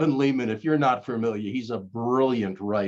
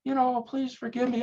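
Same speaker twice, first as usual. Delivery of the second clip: high, whiny mock grovel; over-the-top groveling apology; 13 semitones higher than usual. Shown as a demonstration. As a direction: plead pathetically, hamming it up. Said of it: "you don't have to grovel".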